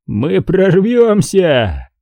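высоко, очень растянутое восклицание; подбодри напарника протяжным возгласом; протяжный возглас. A narrator's reading. Дантек ободряет напарника возгласом. (ободряющий клич, слова врастяжку)

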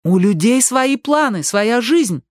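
high, slow, emphatic insistence; insistent. A narrator's reading. Ali defends strangers' lives to the leader, insistent. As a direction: press the point, voice pitched high. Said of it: "настаивала Али".

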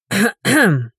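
pointed, stern "ahem"; stern throat-clearing. A narrator's reading. Ulyana confronts a stranger in the yard, stern throat-clearing. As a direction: clear your throat to call out an intruder. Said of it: "громко и строго откашлялась".

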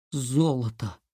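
low, hushed astonishment; stunned. A narrator's reading. Ilya seeing the gold bar unwrapped, stunned. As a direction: let it fall low, awestruck. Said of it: "потрясённо произнёс Илья".

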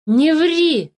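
high, angry cry; shouted. A narrator's reading. Philipp refuses to believe the changeling, angry shout. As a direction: cut the liar off with a shout. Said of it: "закричал Филипп".

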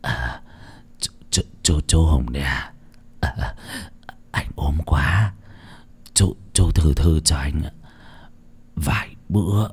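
low, breathless, fading to a whisper; panting weakly. A narrator's reading. Sick debtor pleading with collector, breathless and weak. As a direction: gasp out the plea like a sick man. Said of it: "hổn hển".

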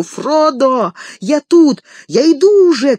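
high, loud calls while running; loud, high cry to the departing boat; shouting. A narrator's reading. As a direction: yell to Frodo from a sprint. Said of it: "кричал он с разбегу".

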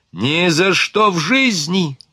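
high, drawn-out cry of defiance; mild yell. A narrator's reading. Vanina refuses to die, a restrained yell. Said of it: "заорала Ванина".

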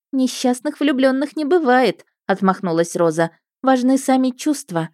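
lightly voiced, airy romantic girl; breezy and sure of love; dreamy young woman. A narrator's reading. Roza voiced, airy and bright.

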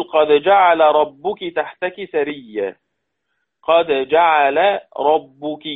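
جعل ربك slow, the L of جعل merged into a doubled R, "jaʿarrabbuk"; slow Quran recitation merging the L into the R. Shown as a demonstration. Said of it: "اللام مفتوحة".

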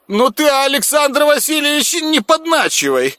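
high whiny protest. Acted. Huntsman protests being teased.

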